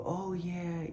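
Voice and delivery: mock placating agreement. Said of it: "people in your life are gonna go".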